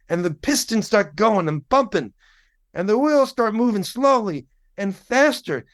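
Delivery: building, high-pitched gospel fervor; gospel singer storytelling. Retold or imitated. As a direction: work up the train story like a revival singer. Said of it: "And she goes".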